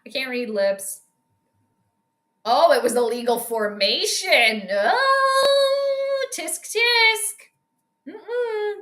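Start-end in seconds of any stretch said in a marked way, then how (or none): 4.7-8.7 high, drawn-out "oh" of mock dismay; tut-tut in exaggerated high disapproval. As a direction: playfully scold in a high, sing-song voice.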